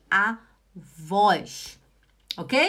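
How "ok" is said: ordinary talk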